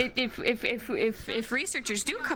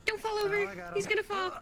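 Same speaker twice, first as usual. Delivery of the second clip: worried; 10 semitones higher, faster than usual. Real, not acted. Warning teammate stumbling.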